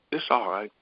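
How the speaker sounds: half-whispered inner voice; hushed imitation of a quiet voice; retold quiet whisper. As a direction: let it out almost without voice. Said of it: "It was a quiet whisper".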